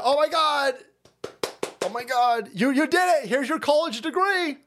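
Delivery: high, gushing fake praise; sarcastic high-pitched celebration; mock cheering crowd. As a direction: gush over her like a cheerleader.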